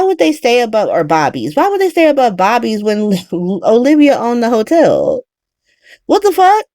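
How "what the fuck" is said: pitched way up in sudden disbelief; what the hell, high and incredulous; shocked disbelief. Real, not acted. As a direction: blurt it out, stunned. Reacting to the plot in disbelief.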